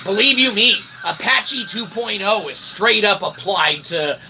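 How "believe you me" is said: normal talking voice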